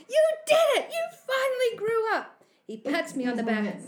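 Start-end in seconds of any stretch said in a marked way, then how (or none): 0.1-2.4 high, loud congratulating shout; gleeful yell. A narrator's reading. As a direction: cheer them on at full voice. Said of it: "yells Flogger".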